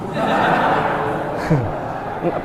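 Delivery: tawa berbisik terkekeh; tawa tertahan. Real, not acted. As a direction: terkekeh tanpa suara. Tertawa melihat neraka. (jamaah tertawa, penceramah terkekeh pelan)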